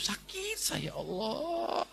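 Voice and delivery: high whimpering wail of a hurt woman; mock crying.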